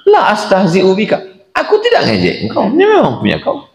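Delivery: high, laughing through the words; laughing reassurance. Retold or imitated. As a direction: chuckle while insisting it's true. Employer laughs reassuring the worker.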